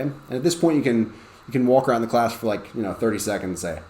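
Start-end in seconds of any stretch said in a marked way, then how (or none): none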